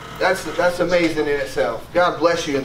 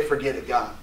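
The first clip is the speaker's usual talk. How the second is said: a softer, lower, dismissive shrug; giving up; 7 semitones lower, 7 dB quieter than usual. Retold or imitated. Believer giving up on God, muttered dismissively. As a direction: toss it off like you're done caring. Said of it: "we just say".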